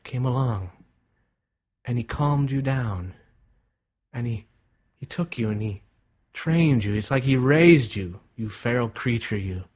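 usual speaking voice

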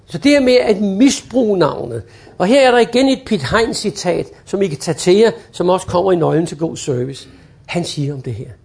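ordinary delivery

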